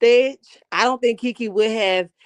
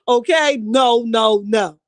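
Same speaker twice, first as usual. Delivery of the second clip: usual speaking voice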